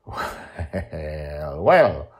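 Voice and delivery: usual speaking voice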